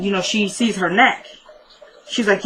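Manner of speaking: ordinary delivery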